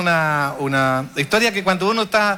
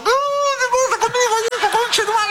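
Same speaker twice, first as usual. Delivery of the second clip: much higher, indignant cursing dog voice; dog cursing out the mother, much higher and outraged; outraged talking dog. Retold or imitated. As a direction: give the burnt dog a high, outraged voice. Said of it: "si hablara el perro".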